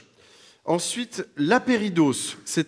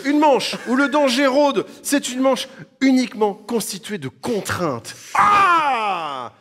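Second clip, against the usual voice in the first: voix de présentateur dramatique; 8 semitones higher than usual. Acted. Host announces a dangerous round.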